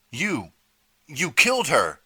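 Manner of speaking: high, loud, stammering accusation; furious accusation; accusing shout. A narrator's reading. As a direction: hurl the blame at him. Artie accuses PJ.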